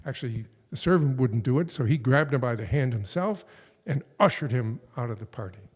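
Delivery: normal talking voice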